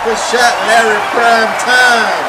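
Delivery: loud excited cheer; cheering shout. Real, not acted. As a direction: yell it out in triumph. Cheering the eagle putt.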